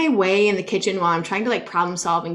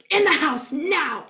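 stern order; 7 semitones higher, slower than usual. Retold or imitated.

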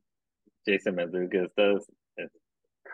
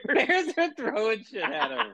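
high-pitched laughing through the words. Real, not acted. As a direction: laugh the line out. Laughing at a funny image.

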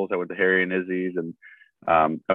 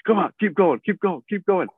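high-pitched mock spotter's yell, urging on; imitated yelling; 11 semitones higher than usual. Guest imitates the mic'd spotter amping up a lifter, mock yelling. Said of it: "like, yelling".

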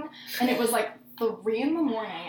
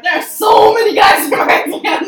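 mock exasperated yell; 6 semitones higher, 11 dB louder than usual. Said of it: "I was like".

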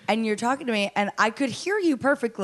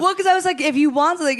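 excited; 7 semitones higher, 4 dB louder, faster than usual. Real, not acted. Excited retelling, raised voice.

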